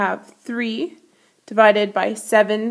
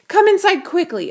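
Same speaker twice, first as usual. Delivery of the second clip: urgent; 10 semitones higher, 4 dB louder than usual. A narrator's reading. Mother urges son inside.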